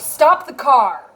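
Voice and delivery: loud, drawn-out command, each word leaned on; shouted order. Retold or imitated. Instructor orders driver who hit the pole to stop, shouting. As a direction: imitate an instructor yelling to halt, slowly and forcefully.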